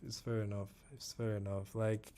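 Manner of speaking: ordinary talk, nothing marked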